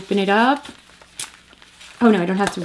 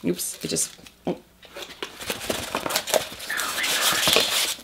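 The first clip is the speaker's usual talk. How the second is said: breathless whisper of awe; awed whisper; 9 dB quieter than usual. Real, not acted. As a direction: whisper it in amazement. Whispered amazement.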